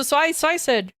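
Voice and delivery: ordinary talk, nothing marked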